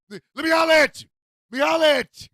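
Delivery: high, loud, forceful shout; aggressive holler. Acted. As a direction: bellow it as a pushy demand. Demonstrating the aggressive street call.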